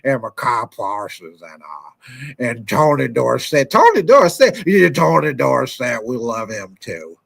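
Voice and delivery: low, very slow halting owner voice; a deep, dragging mock press conference; Jerry Jones impression. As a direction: stretch every word like a rambling team owner. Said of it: "that's my old Jerry Jones impression".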